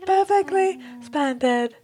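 high, slow imitation of a British girl; girl saying "perfectly splendid". Kat taking up the comparison, imitated British girl's voice. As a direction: play up the little English girl. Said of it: "the girl that says "perfectly splendid".

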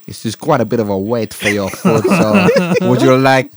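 slow, loud Greek waiter voice; Greek accent. Retold or imitated. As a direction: stretch out some breads slowly. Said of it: "Greek, I think".

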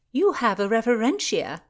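amused exclamation, high and loud; laughing. A narrator's reading. Constance teases her saucy friend, amused and laughing. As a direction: tease it out brightly, as if about to laugh. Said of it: "Constance laughed".

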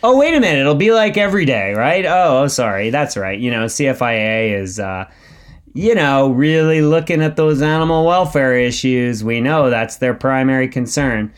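exaggerated fake-apologetic correction; sarcastic. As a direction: pretend to suddenly remember, dripping with irony. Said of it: "Do I detect some sarcasm?".

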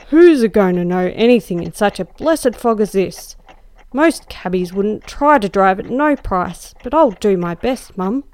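a coarse cabman excusing himself; gruff apologetic cabby. Acted. Cabby excusing himself to the lady passenger, gruff and apologetic. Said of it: "he answered in a gruffy, apologetic tone".

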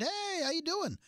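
imitating a breezy, high-pitched greeting; mock-cheerful hello, pitched well up; friendly and upbeat. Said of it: "Logan is friendly and upbeat".

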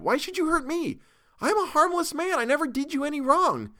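high, pleading frightened voice; pleading in terror. A narrator's reading. Captive begs the mob to spare him, high pleading. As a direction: beg for your life. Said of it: "I cried out".

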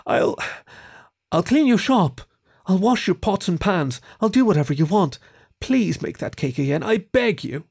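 high, thin begging; desperate plea. A narrator's reading. Kieran offers chores to the baker, pleading desperately. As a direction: offer anything, stumbling into the plea. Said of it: "pleaded with a thin, cracking voice".

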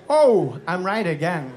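light impression, exclaim then settle; Christopher Walken. Actor turns round to the comic, lightly mimicked pleased exclamation. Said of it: "he turns around and goes".

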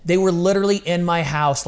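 normal talking voice

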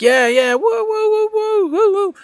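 very high, hooting fan chorus; mock fan cheering. Imitating fans replying to a teaser, high hooting cheers. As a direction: imitate the whole crowd hooting with excitement. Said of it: "everybody's like".

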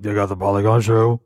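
low, put-on adolescent boy voice; changing teen voice. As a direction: imitate a teenager whose voice is dropping. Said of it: "His voice is changing. So he's more like".

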